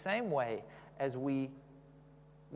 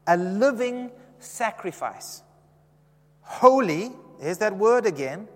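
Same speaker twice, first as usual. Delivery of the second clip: impassioned preaching; 6 semitones higher, 8 dB louder than usual. Acted. Preacher pressing Romans twelve on the congregation, impassioned and loud. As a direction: lift the verse up like a rising proclamation.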